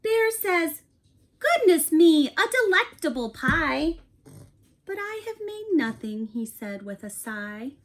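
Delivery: animated picture book read-aloud; reading to children. A narrator's reading. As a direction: read it like bedtime story time for a class. Teacher reads picture book aloud.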